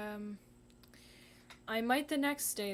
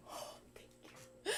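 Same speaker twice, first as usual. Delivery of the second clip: breathless relief; 6 dB quieter than usual. Real, not acted. Relief after flipping canvas.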